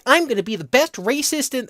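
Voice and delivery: put-on high, eager anime girl. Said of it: "girl with green hair being like".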